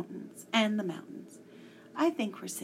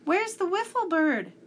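loud worried call; anxious call; 10 semitones higher, 8 dB louder than usual. Acted. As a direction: call out in alarm. Tom anxiously calls out.

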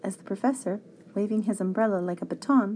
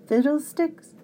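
cranky grumble, pitched up; mild exasperated muttered oath; grumbling; 6 semitones higher, 4 dB louder than usual. A narrator's reading. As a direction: repeat it in higher-voiced annoyance. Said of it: "the professor grumbled".

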